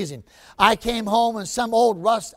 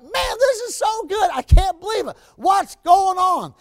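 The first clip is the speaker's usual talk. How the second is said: a husband thrilled by his wife's surprise dinner; overjoyed; 9 semitones higher than usual. Retold or imitated. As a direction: burst out in amazed delight. Husband overjoyed seeing his wife.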